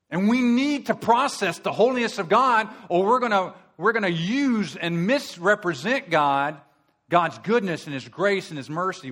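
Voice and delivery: a preacher warning of brimstone; fiery preaching. Acted. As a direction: thunder out the judgment. Preaching at full force.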